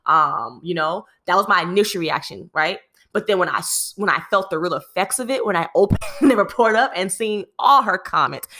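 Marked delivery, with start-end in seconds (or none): none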